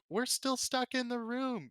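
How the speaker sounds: put-on high, slow frightened kid calling through the door; children stuck behind a door calling out high. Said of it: "And they're like".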